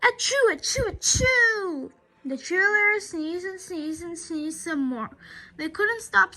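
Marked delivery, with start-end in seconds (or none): 0.0-1.9 loud higher sneezing; sneezes. Acted. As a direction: sneeze over and over. Cheerleaders sneezing repeatedly.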